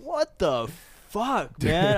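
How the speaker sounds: high-pitched incredulous outburst; retold disbelief. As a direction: act out exasperated disbelief, voice jumping up. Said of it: "I looked over and I was like".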